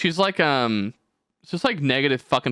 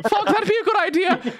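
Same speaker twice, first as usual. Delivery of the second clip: very high, silly villager voice; hammed-up comic peasant; dim Irish villager. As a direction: pitch it way up, floating a bright idea. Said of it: "one of those dumb motherfuckers was like".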